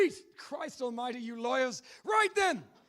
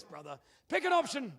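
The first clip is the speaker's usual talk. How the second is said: ordinary delivery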